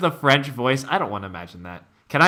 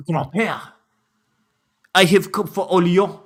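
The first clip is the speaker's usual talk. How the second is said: mock French; 3 semitones higher than usual. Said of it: "she must be from Orléans".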